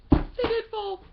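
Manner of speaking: high, loud startled cry; startled dismay. Real, not acted. Vlogger to viewers as the books fall, startled dismay. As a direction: yelp it in real dismay. Books just fell over.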